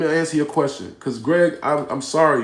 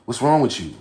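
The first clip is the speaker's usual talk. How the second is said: ordinary talk, nothing marked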